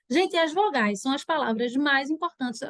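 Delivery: ordinary delivery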